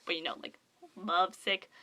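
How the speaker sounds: usual speaking voice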